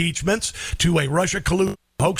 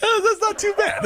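high-pitched, laughing praise; amused, laughing reaction; laughing approval. Real, not acted. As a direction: talk through real laughter. Laughing at guest's redneck accent.

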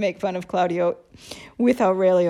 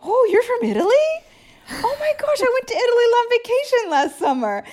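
much higher, gushing sudden friendliness; gushing cop; 5 dB louder than usual. Retold or imitated. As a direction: switch to a starstruck, delighted tourist voice. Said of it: "And they're like".

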